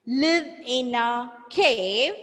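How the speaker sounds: very slow, rising teacher modelling; slow repeat-after-me. Shown as a demonstration. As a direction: stretch each word, lift the last. Model sentence for pupils to repeat.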